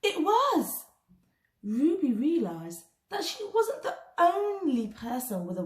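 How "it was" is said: much higher, louder burst of discovery; surprised exclaim. A narrator's reading. Narrator revealing the boy's worry to young listeners, excitedly. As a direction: exclaim it like a gasp of recognition.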